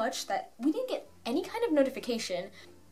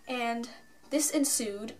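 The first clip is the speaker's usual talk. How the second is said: ordinary delivery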